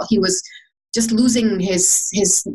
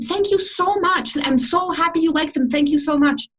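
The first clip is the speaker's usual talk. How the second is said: grateful thanks; 6 semitones higher than usual. Retold or imitated. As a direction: thank her like she gave you a gift. Thanking the woman warmly.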